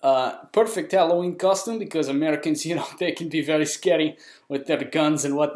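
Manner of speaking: hammed-up Russian accent. Shown as a demonstration. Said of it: "Now you get my Russian accent".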